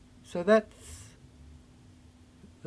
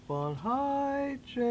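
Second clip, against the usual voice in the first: hushed slow call; 12 dB quieter, much slower than usual. Acted. Name said low after calling.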